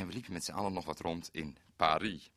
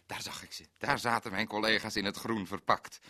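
ordinary talk, nothing marked

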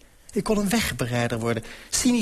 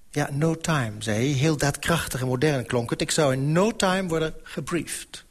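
plain reading voice

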